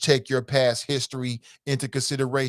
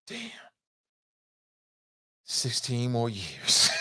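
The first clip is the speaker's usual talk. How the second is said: mock despair; 3 semitones lower, 5 dB quieter, much slower than usual. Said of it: "be like".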